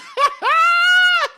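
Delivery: a shrieking high laugh; loud high laugh. Real, not acted. Laughing at the goblins making omelets, a loud shrieking laugh. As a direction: crack up loudly.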